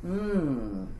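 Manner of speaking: low, soft "mm"; feigned attentive hum. Shown as a demonstration. Principal tuning out a screaming teacher, low vague hum. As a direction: hum noncommittally, checked out. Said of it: "If I got a teacher screaming at me".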